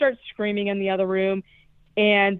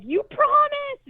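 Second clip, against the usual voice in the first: very high, drawn-out mock wail; mock outraged wail; slower than usual. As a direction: wail it in drawn-out fake betrayal. Acting out gargoyle's outraged cry.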